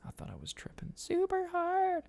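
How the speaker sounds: breathy laughing whisper through the words. Real, not acted. Telling a friend about the trip, whispering through a laugh. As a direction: laugh it out under your breath.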